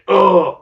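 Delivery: loud imitated tired grunt; mock grunt. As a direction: grunt it out like someone exhausted. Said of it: "I grunted at you".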